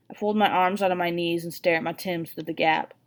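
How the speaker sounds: ordinary delivery, nothing marked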